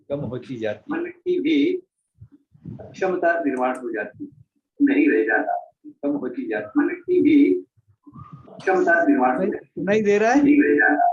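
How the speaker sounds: repeating echo on the line. Real, not acted. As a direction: voice doubling back like an echo. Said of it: "sir, इको आ रहा है".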